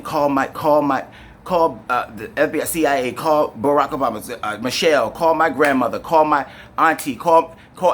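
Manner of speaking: high, loud, pleading calls rising toward the end; retold screaming for help. Pinned down by police, shouting for someone to call his family. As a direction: yell for anyone to call for help, building. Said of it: "I'm screaming. I'm saying".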